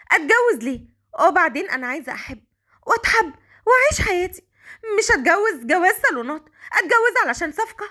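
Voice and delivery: high-pitched outraged protest; frantic, angry. A narrator's reading. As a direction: protest in a high, near-tearful fury. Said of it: "وبتقول بعصبية".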